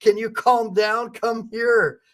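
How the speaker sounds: lightly voiced cop chasing her down; mock cop voice. Officers calling after a mother, lightly mimicked. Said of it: "officers after her saying".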